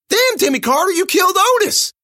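high, loud startled cry; shocked outburst. Acted. Narrator shocked at Timmy killing Otis, high loud cry. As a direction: blurt it out in shock.

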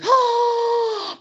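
a high, sudden "¡Oh!" of recognition; sudden surprise. Retold or imitated. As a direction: gasp it out, struck by a revelation. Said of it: "escuchas una conversación y dices".

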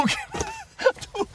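normal talking voice

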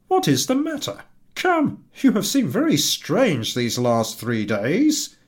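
trying to soothe her; coaxing husband. Acted.

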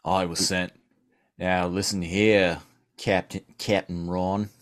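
put-on low, slow Australian accent; Australian polar bear. Polar bear telling the captain who sent him, low Australian. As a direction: drop low and soft, drawl in Australian. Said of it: "leaning into my Australian polar bear".

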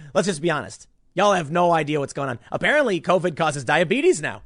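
normal talking voice

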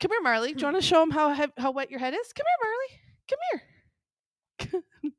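high sing-song call to a dog; talking to a dog. Real, not acted. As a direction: coax the dog over, high. Calling dog to show camera.

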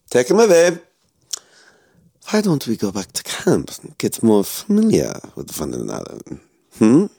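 a cold villain toying with his prisoner; menacing German officer. Acted. Officer orders the soldier taken.

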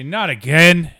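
loud, exasperated shout; battle cry. Acted. Player grabbing the mace to charge the beast, loud cry. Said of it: "I grab the mace and I say".

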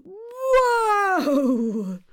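high, long falling whoa; calling to a horse. Shown as a demonstration. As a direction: rein in a horse with a big whoa. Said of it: "Now you're on a horse".

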